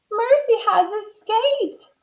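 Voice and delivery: very high-pitched wife; Missus Watson. A narrator's reading. Missus Watson seeing the pig escape, high-pitched. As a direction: announce it in a high voice. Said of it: "said Missus Watson".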